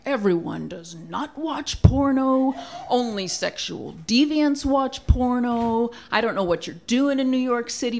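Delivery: hammed-up angry dad lecture. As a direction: lecture like an appalled father. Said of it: "this very angry lecture".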